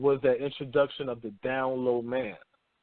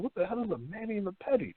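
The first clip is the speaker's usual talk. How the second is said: baffled question; 6 semitones higher, 5 dB quieter, faster than usual. Retold or imitated. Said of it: "I'm like".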